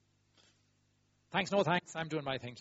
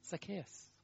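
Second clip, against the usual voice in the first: soft calling; 3 semitones higher, 12 dB quieter than usual. Acted. Jesus calls him softly.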